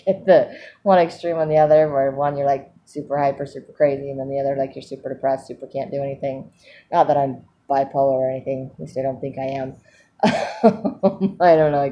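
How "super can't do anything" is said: usual speaking voice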